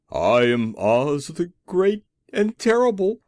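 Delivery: loud, high, shaky attempt at grandeur; frightened little man claiming to be great; trembling voice. A narrator's reading. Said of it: "in a trembling voice".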